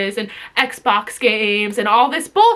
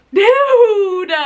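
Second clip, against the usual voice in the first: exasperated shout; 6 semitones higher, 5 dB louder than usual. Real, not acted. Exasperated outburst.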